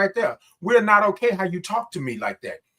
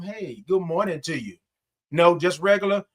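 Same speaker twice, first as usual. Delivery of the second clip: normal talking voice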